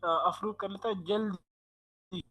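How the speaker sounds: glitchy, distorted internet audio; distorted bad connection. Real, not acted. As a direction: voice cutting out over a bad line. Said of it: "distortion आ रही है आपकी आवाज में".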